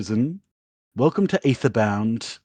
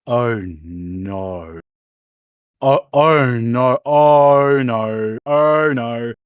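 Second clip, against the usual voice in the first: mounting dread; 3 semitones lower, 3 dB louder, much slower than usual. Retold or imitated. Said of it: "you have this moment of you're like".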